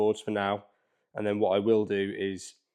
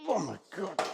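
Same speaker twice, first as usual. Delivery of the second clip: straining; 7 semitones higher, 5 dB quieter than usual. Real, not acted. Straining to lift the heavy board.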